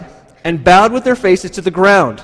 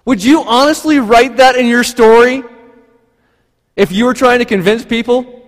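ordinary talk

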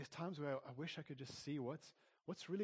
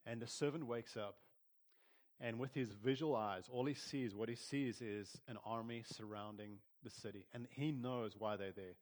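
ordinary talk, nothing marked

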